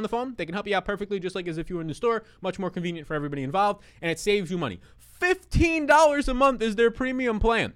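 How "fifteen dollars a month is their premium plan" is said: slow, high and loud on the price; hyped ad pitch. Real, not acted. Host pitching Mint Mobile price to viewers, excited. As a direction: sell the price like a big deal.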